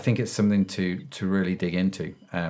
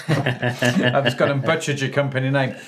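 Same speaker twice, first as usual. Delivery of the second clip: laughing; 3 semitones higher than usual. Real, not acted. Laughing at own mispronunciation.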